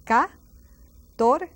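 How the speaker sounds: "Catorce" broken up as ka, TOR, seh with pauses; two different C's. Shown as a demonstration. Teacher showing two C's pronounced differently, "Catorce" syllable by syllable. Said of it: "two C's pronounced differently".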